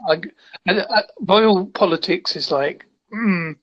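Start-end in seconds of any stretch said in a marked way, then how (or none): none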